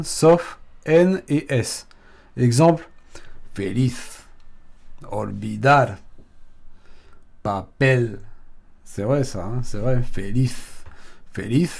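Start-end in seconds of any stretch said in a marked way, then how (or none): 3.5-4.2 feliz stressed on its end: fe-LIZ; final-syllable stress. Shown as a demonstration. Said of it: "Sur la dernière syllabe". 5.1-6.0 olvidar stressed on "dar"; final-syllable stress. Shown as a demonstration. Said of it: "Sur la dernière syllabe". 7.4-8.2 papel stressed on "pel"; final-syllable stress. Shown as a demonstration. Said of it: "Sur la dernière syllabe".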